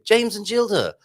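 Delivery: put-on high, excited kids' show announcement; sing-song title call for little viewers; children's program presenter. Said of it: "Sounds like a children's program".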